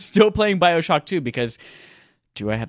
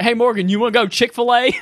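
mock North Carolina accent, higher and louder; joking Southern accent; 9 semitones higher, 5 dB louder than usual. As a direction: playfully put on a Southern voice. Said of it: "it starts out as a joke".

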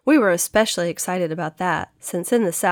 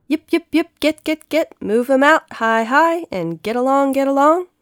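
high, loud herding calls; cowboy shouting; 8 semitones higher, 7 dB louder than usual. Acted. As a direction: holler like a cowboy driving horses. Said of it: "It was the cowboys shouting at the horses".

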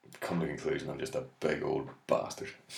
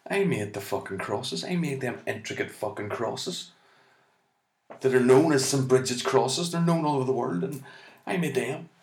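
aggrieved Bridget; 8 semitones higher than usual. Retold or imitated. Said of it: "she's sitting there going".

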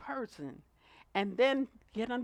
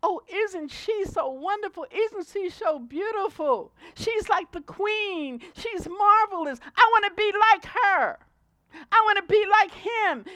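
higher, louder gushing admiration, lightly voiced; mock adoring fan; 10 semitones higher, 11 dB louder than usual. Preacher mimicking starstruck fans, a mock gushing tone. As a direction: gush over a celebrity, hammed-up. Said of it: "so you can lay down and relax and say".

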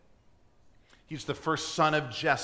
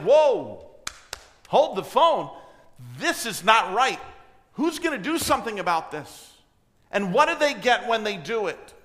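louder, higher outraged exclamation; a young man fired up by injustice; mock-shocked teenager protesting; indignant David; 5 semitones higher, 5 dB louder than usual. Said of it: "David's like".

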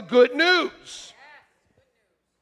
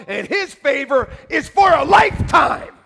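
sudden loud, high climax on the last words; full-force preacher shout; preaching shout; 7 semitones higher, 10 dB louder than usual. Real, not acted. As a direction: belt it out to the congregation.

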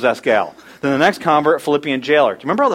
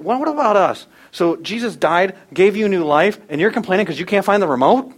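high, raised voice of scornful disbelief; mock incredulity; 4 semitones higher than usual. Future readers scoffing at our complaining, high and incredulous. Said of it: "And then".

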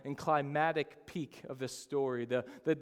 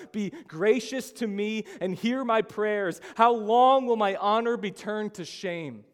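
loud, higher-pitched desperate plea; David crying out in distress; 6 semitones higher, 9 dB louder than usual. Acted. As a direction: cry out to God in distress. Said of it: "starts in complete distress when David cries out".

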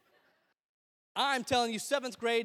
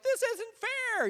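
high, drawn-out whine, much slower; whiny player; much slower than usual. Retold or imitated. Players complaining about the aggressive kid, whining high and slow. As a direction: whine it like a sore-loser kid. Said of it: "the players are like".

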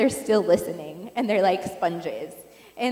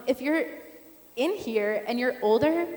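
ordinary talk, nothing marked

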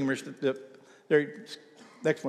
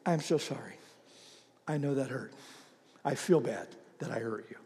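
softer, lower and sincere; gentle apology; 5 semitones lower, 9 dB quieter than usual. Shown as a demonstration. Pastor modelling an apology to someone you hurt, soft and tender. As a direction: apologize quietly from the heart. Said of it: "you have to go".

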